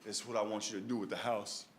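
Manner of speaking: low, soft voice of a crying mother; tearful mother. Retold or imitated. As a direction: gently break hard news, understated tears.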